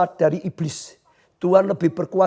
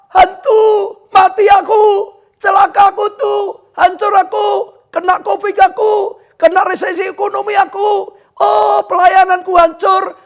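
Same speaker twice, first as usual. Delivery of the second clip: high, loud terrified shouting with a sob; panicked screaming; 12 semitones higher, 6 dB louder than usual. Acted. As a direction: scream out every fear in panic. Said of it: "kita akan teriak-teriak keluar dari mulut kita". (teriakan panik penuh ketakutan)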